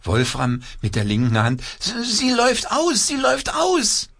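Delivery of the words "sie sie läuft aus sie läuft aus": very high panicked puppet voice; alarmed puppet. A narrator's reading.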